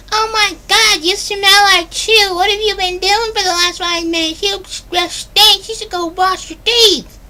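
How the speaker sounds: put-on very high, loud, slow falsetto girlfriend voice; nagging girlfriend. Girlfriend scolds her boyfriend for smelling of chew, in a high, slow, loud falsetto. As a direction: ham up a disgusted girlfriend complaining. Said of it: "she's like".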